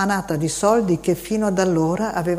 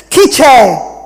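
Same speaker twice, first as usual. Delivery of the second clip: loud, rough startled bellow; terrible shout; 7 semitones higher, 13 dB louder than usual. A narrator's reading. The man startled by the boy, terrible loud shout. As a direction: roar it out, menacing. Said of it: "gridò con voce terribile".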